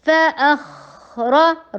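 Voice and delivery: فَأَخْرَ slow with خ flowing; soft flowing kh. Shown as a demonstration. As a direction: let the خ run on in فَأَخْرَ. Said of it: "خا نرم حروف میں سے چلاتے چلے جائیے". (فَأَخْرَ میں خا کو چلاتے ہوئے)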